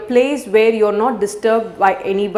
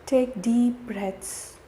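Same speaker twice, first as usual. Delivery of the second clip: softer, unhurried meditation voice with long pauses; guided meditation; 12 dB quieter than usual. Real, not acted. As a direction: guide the listener gently through each breath.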